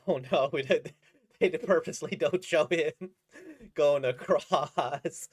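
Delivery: loud, laughing through it; half-laughing alarm; laughing mock panic. Laughing at the manga fake-out, loud.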